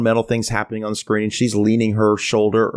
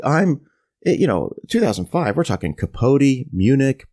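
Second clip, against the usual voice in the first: ordinary delivery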